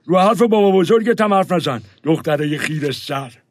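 loud angry scolding of a granddaughter. Acted. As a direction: put the cheeky girl in her place.

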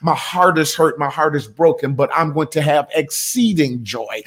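ordinary talk, nothing marked

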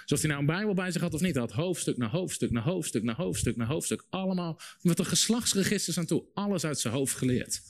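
ordinary talk, nothing marked

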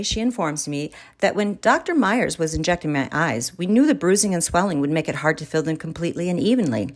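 usual speaking voice

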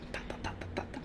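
light unvoiced tapping sounds for a kid jumping; soft patter like small feet bouncing; quiet mock tapping; tapping noise. Imitating the kid kicking again.

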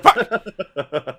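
frustrated yell. Retold or imitated. Frustrated at dying again in game.

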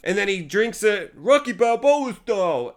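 normal talking voice

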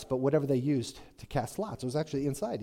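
normal talking voice

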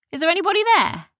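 high, quick call down a hole; high quick call down the burrow. Acted. Calling into the hole.

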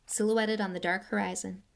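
plain reading voice

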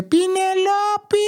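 high, drawn-out yelled call of a name; old granny calling out. Retold or imitated. Grandma yelling for his mother from the street, high and drawn out. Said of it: "φώναζε τη μάνα μου κάθε φορά και έλεγε".

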